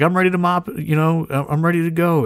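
mock-eager volunteer ready to mop; eager helper. Eager mop guy voiced.

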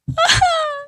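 a high, choked laugh close to tears; tearful laugh. Real, not acted. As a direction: laugh through welling tears. Emotional moment, tearful laugh.

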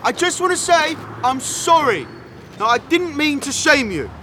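high pitched calls upward; calling up to window. Acted. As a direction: call up to the window, apologetic.

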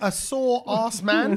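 drawn-out, careful reading of an odd name; slow sounding-out. Real, not acted. Asking colleagues about a strange name, slowly sounded out. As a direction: stretch each word out as if puzzling it over.

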